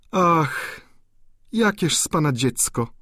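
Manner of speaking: exclaiming viscountess. A narrator's reading. Viscountess exclaims at the naive student, fond. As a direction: cry out fondly at his naivety. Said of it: "zawołała pani Dubuzon".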